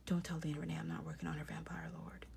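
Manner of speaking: hushed mock-secret aside; conspiratorial whisper. Telling viewers a secret to keep from Lena Renee, conspiratorial whisper.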